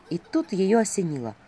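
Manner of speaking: ordinary delivery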